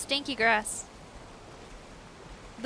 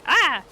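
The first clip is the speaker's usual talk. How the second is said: short loud mock scream "Ah!"; retold scream; 7 semitones higher, 14 dB louder than usual. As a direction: yell it out like you got jumped. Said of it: "and I was like".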